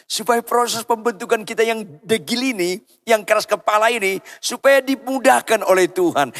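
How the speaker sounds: high-pitched pulpit appeal; preaching exhortation. Real, not acted. Preacher praying the shaping be made easy, exhorting. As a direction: lift the voice into a preacher's plea.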